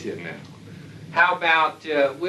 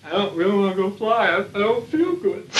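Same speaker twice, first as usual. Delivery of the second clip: whiny pilot; 5 semitones higher than usual. Retold or imitated. Pilot whining to his squadron commander, mock whine. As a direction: play a pilot begging off flying, pitched up.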